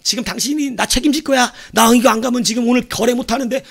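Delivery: high, pressing mock complaint; angry demand. Passenger confronting airline staff over a cancelled flight, angry demand. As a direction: play a furious customer blaming staff.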